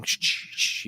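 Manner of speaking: whispered shh from the film's soundtrack; imitated shushing. Imitating the eerie movie noise, whispered shush. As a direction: breathe out a spooky shush. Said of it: "And you hear, like, something".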